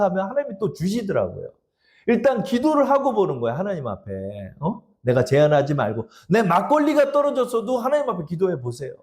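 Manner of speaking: usual speaking voice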